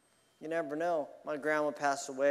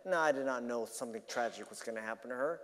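normal talking voice

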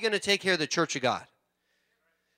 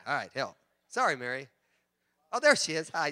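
normal talking voice

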